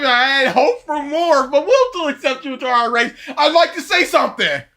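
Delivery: lightly voiced sneering Dark Lord; mock Voldemort. Fan imitating Voldemort mocking Neville. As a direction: sneer at him with a chuckle. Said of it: "when Neville comes up".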